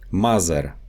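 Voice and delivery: "Mother" said as mazer; Russian Z for TH in "Mother"; Russian accent. Shown as a demonstration. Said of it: "The T H sound".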